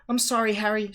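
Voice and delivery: high, loud wailed apology; wailing. A narrator's reading. Hermione confessing she lost Snape, wailing. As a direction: wail it in dismay. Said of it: "she wailed".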